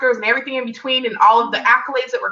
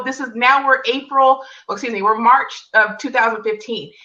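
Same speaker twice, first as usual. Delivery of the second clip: ordinary talk, nothing marked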